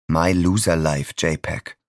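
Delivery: usual speaking voice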